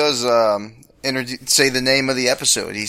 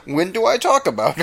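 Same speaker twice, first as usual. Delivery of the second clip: high-pitched indignant protest; mock indignation; 8 semitones higher than usual. Imitating the date denying his rant, high indignant voice. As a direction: throw it back in mock disbelief. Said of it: "He gets right off this rant".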